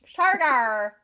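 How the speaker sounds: Shargar with an Irish lilt; Irish accent. Retold or imitated. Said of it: "That was the Irish pronunciation".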